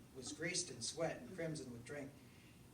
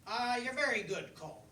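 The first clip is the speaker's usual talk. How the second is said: higher and louder, a cocky fox greeting his friend; cheeky fox; 7 semitones higher, 8 dB louder than usual. Acted. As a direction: boom it out like a sly pub fox. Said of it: "the fox said".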